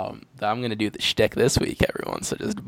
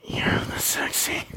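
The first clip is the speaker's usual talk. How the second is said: breathy mock seductive whisper; teasing whisper. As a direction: whisper it like a secret. Teasing outro shtick.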